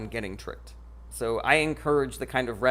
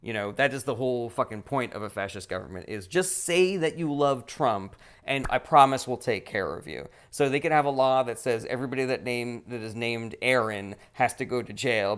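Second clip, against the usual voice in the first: ordinary delivery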